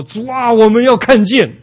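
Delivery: loud, high-pitched cry to the Lord; direct, bold plea. Retold or imitated. Blind men answering Jesus, loud and direct plea. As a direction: call it out forcefully, straight to the point. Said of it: "他们就直截了当地跟主说".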